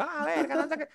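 very high mock kid's wail; niño protestando. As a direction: imitate a child protesting at punishment. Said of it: "y yo". (quejido agudo de niño)

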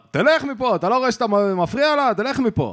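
high, loud angry yell; mock furious shout at the harasser. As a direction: chase him off, shouting. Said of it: "ואמר לו".

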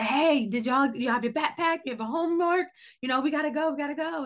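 mock rush-hour holler up the stairs; yelling to the kids. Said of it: "I yell it out again".

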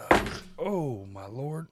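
slow drawn-out groan mid-stretch. Real, not acted. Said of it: "Sorry, I went to stretch".

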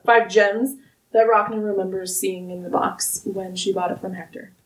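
normal talking voice

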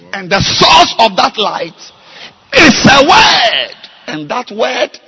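loud, climbing pulpit rebuke; preaching at full force. Acted. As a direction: hammer the congregation with each question. Pastor preaches on the light.

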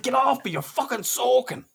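high-pitched retold protest; mock-disgusted complaint; irritated recoil. Acts out recoiling from wet partner.

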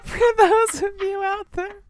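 high, loud, helpless laughter; barely able to talk for laughing; cracking up. Real, not acted. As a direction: squeal the words out through laughter. Both crack up over the slip.

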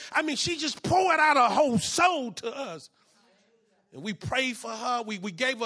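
loud, rising preacher's testimony; full-force preaching. Acted. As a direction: drive the story home to the congregation.

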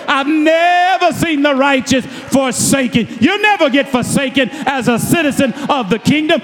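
fiery sermon delivery; full-force preaching. Acted. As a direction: preach it out to the congregation.

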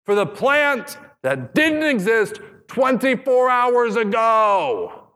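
very high, slow petulant insisting; high mock whine, drawn out; whiny Jonah. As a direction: whine it like a sulking child. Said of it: "What's he say?".

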